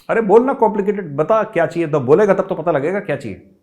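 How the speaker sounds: high, loud, brushing off the excuse; impatient chairman. Retold or imitated. Chairman dismissing 'it's complicated' and demanding an answer, high and loud. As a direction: wave away his hesitation, loudly.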